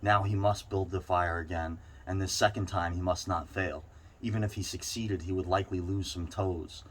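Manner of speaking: plain reading voice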